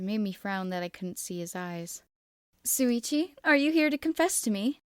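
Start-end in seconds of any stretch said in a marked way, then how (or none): 2.6-4.8 higher, louder sing-song tease; teasing. Acted. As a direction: needle him playfully, voice lifted. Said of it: "I teased".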